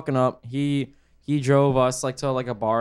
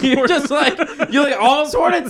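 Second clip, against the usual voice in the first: high, loud, laughing through words; 9 semitones higher, 5 dB louder than usual. Real, not acted. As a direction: laugh while telling it. Laughing at a pouring mishap.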